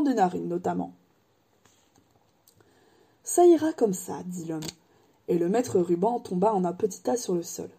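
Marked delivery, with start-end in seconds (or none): 3.2-4.2 lightly voiced, wistful old wandmaker; a hint of an old craftsman remembering. Ollivander remembers Harry's mother, lightly voiced old man.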